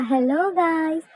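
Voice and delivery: slow, sing-song mock greeting call; two moms calling out cheerfully, a touch hammed up; mock shout. A narrator's reading. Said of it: "दोनों ने चिल्लाया".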